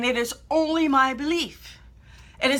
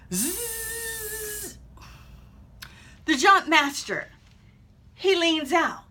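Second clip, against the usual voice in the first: normal talking voice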